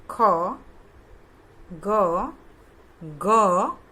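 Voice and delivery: ख, ग, ग called out loudly, one drawn-out letter at a time; letter recitation demo. Shown as a demonstration. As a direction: recite ख, ग, ग firmly, with pauses between. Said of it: "आपको उसका उच्चारण करके". (ख, ग, ग ज़ोर से, अलग-अलग उच्चारण)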